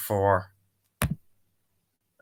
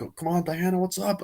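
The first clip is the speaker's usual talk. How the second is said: mock urgent; 8 semitones higher than usual. Character urging during chase.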